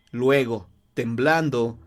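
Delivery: usual speaking voice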